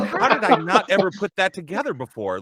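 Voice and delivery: high, loud imitation of a stunned friend; astonished realisation.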